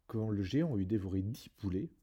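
ordinary delivery

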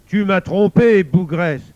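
loud, furious accusation; angry demon. Acted. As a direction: thunder at the one who cheated you. Le démon accuse la femme. (démon furieux, voix tonnante)

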